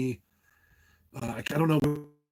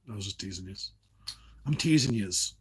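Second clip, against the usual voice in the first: casual Jersey "teasing yous"; Jersey accent; 4 semitones lower than usual. Shown as a demonstration. Teasing the vapers in Jersey talk, light Jersey accent. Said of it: "That's what we say in Jersey".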